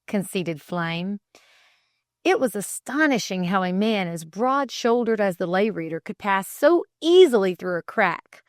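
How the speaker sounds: plain reading voice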